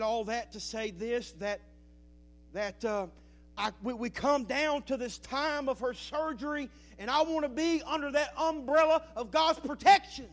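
loud, rising preacher's build; preaching at full force. Real, not acted. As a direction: lift the church with it. Preaching to the congregation.

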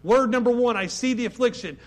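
fervent preacher voice, pushing hard; preaching. Acted. Preacher urging his congregation, fervent preaching. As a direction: preach it with rising fire.

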